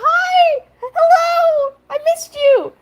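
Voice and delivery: very high, shouted, laughing hello; overjoyed greeting. Retold or imitated. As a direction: burst in like a mate thrilled to see him. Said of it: "So he runs over, he's like".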